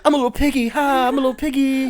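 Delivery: high squeaky little pig; squeaky piggy voice. Retold or imitated. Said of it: "I'd be like".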